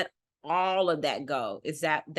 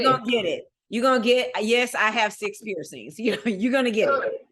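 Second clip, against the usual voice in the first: sassy declaration; 5 semitones higher, 4 dB louder than usual. Real, not acted. Declaring her hoop earrings, tattoos.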